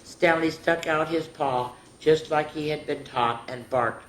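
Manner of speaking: put-on lively read-aloud for little kids; storybook narration. A narrator's reading. Teacher reading Stanley's school story to children. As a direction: tell it like a bedtime story to a class. Reads picture book to children.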